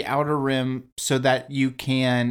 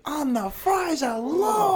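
incredulous; 13 semitones higher, slower than usual. Retold or imitated. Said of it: "And I was like".

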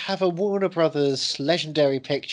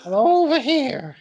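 silly put-on voice; 8 semitones higher, 6 dB louder, slower than usual. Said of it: "your bloody voice".

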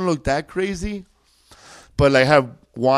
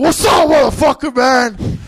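high, loud threatening yell; retold shout; 8 semitones higher, 4 dB louder than usual. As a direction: yell it like you're about to swing a bottle. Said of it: "So I said".

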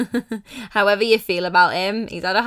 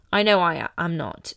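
usual speaking voice

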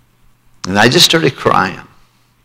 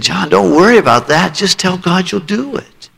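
reassuring mentor; 8 semitones higher than usual. Retold or imitated. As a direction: ease the boy's worry tenderly. Mentor reassures crying boy.

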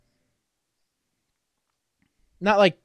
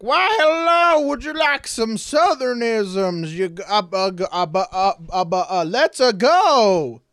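high, slow, stammering Southern gentleman; mock Jon Voight stumbling through a Southern accent; Jon Voight's odd Southern drawl; 6 semitones higher, 4 dB louder than usual. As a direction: ham up a halting Southern charm. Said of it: "That's, that's how Jon Voight fucking talks".

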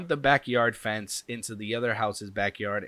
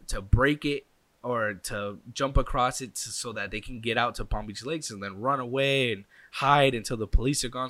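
normal talking voice

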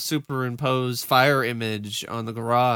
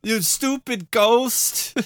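mock challenge; 9 semitones higher, slower than usual. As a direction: pick a fight with the ghost, overacted. Mock fight with ghost.

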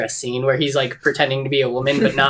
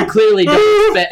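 much higher jokey Cockney squeal; mock Cockney; 4 dB louder than usual. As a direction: overdo a Cockney voice. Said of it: "this wonderful Cockney accent I've got here".

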